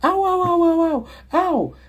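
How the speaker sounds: high, far-off mock cries of pain from a woman; high-pitched imitated yelps of pain; lady crying ow. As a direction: squeal ow like a hurt lady. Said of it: "the lady".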